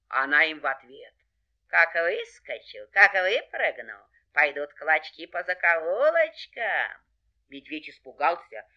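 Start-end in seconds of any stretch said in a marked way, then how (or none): 1.7-7.0 slow, drawn-out threat; threatening fox. Acted. Fox scares off the bear, menacing. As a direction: stretch each menace out firmly.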